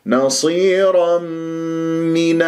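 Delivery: نصيرا من drawn out, slow and melodic; slow tajweed chanting; slow Quran recitation. Shown as a demonstration. Teacher models Quran recitation.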